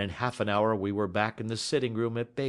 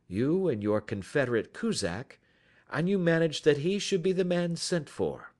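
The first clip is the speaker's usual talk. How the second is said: lightly voiced accusing detective; Sherlock Holmes; 6 semitones higher than usual. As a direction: lay out his guilt point by point, assured.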